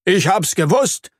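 high, triumphant interruption; proud exclamation. Acted. Earthworm interrupts the spider's report, proud. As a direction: burst in, proud of yourself. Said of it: "ruft Herr Regenwurm stolz".

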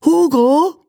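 put-on high-pitched child prince calling out; little prince. Prince to his servant Hugo, high summoning call.

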